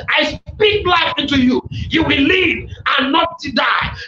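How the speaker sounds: normal talking voice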